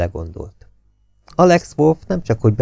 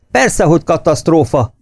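higher, a touch louder angry yell; angry shout; 7 semitones higher, 3 dB louder than usual. A narrator's reading. Enraged officer shouting back about the disaster. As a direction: bark it back in exasperation. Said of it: "ordított Bug".

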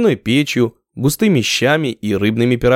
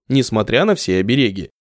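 usual speaking voice

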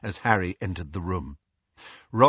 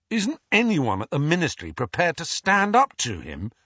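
put-on sharp, indignant young voice raised in protest; angry; 7 semitones higher, 4 dB louder than usual. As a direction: demand it, fed up with the Ministry. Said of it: "asked Ron angrily".

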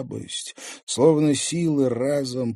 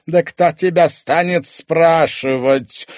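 threatening; 8 semitones higher, 8 dB louder than usual. A narrator's reading. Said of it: "угрожающе произнёс Корф".

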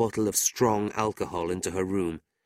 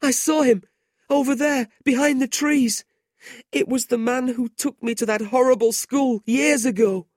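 much higher and louder, panicked; frightened boy crying out; terrified; 7 dB louder than usual. A narrator's reading. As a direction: panic after a nightmare vision. Terrified boy after screaming.

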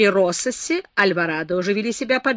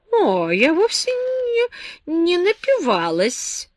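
tipsy denial; 9 semitones higher, much slower than usual. A narrator's reading. Tipsy girl denies being drunk.

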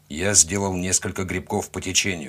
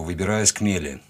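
ordinary delivery

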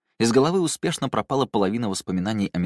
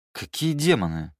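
higher-pitched, baffled question; genuine puzzlement; 5 semitones higher than usual. A narrator's reading. Drunk man has lost the thread of the talk, puzzled. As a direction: ask it as if truly lost. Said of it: "спросил он, искренне не понимая".

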